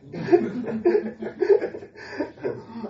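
high, breathless giggling; hearty laugh. Real, not acted. Preacher joking with the congregation, helpless laughter. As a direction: crack up for a few seconds.

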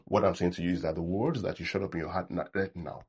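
usual speaking voice

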